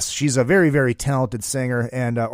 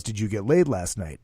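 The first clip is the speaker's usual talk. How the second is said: low, quiet blunt question; low Gene voice; 7 semitones lower, 7 dB quieter than usual. Retold or imitated. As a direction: ask it deep and deadpan. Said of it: "Gene goes".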